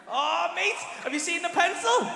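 high, loud burst of enthusiasm; hyped-up shouted raving; excited yell. Acted. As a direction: gush at full volume, pitch shooting up. Raving about the iPad pencil.

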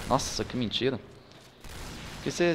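normal talking voice

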